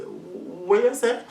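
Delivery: slow, halting stammer on each word. Retold or imitated. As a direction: drag out the words like a stammerer. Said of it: "'Cause I'm stammer".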